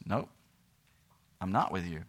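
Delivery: very low, hushed acted answer; quiet refusal. Preacher voices God answering Israel, quietly refusing. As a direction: drop right down and refuse softly. God's answer after loud question.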